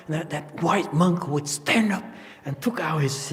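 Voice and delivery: ordinary talk, nothing marked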